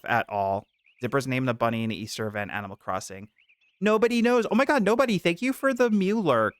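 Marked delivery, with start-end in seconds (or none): 3.8-6.5 excited greeting. Real, not acted.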